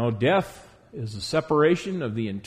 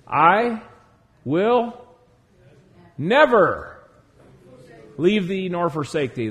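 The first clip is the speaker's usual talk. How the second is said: "I", "will", "never" lifted one by one with pauses; word-by-word scripture emphasis; slow emphatic quote; 3 semitones higher, 3 dB louder, slower than usual. Acted. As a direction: hang on each word, hit "never". Preacher quotes Hebrews, word by word.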